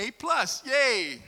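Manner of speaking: high, bright little cheer; a playful yay for the kids; mock cheer. Pastor joking about grandchildren, mock cheering. Jokes about Gen A plus.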